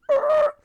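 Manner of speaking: very high, loud mock groan of a whole film set; hammed-up collective howl of dismay; crowd groan yell. Whole set reacts to the ruined take, loud mock howl. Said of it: "the whole set just goes".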